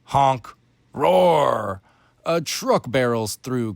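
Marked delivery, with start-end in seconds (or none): 0.0-0.5 a short honked "Honk!" like a horn; truck honk. A narrator's reading. Narrator sounds the truck horn for the children, mock honk. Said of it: "hears some loud humming". 0.9-1.8 a louder drawn-out "Roar!" falling at the end; engine roar. A narrator's reading. Narrator voices the truck's roar, playful engine roar. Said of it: "followed by a roar".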